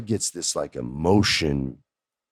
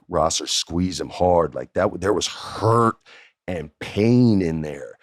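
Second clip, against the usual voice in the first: ordinary talk, nothing marked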